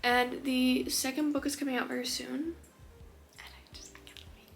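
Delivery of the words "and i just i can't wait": breathless eager whisper; excited whisper. Real, not acted. About the second book coming, eager whisper. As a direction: whisper it, bursting with anticipation. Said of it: "excited hush".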